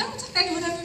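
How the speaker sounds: a mother shrugging it off kindly; consoling mom. Retold or imitated. Mom consoling after car denied.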